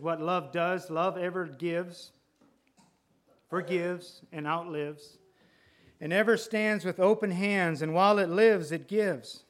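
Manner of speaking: rising recited verse, slowing at the end; poem recitation. Acted. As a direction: read the poem with growing fervour.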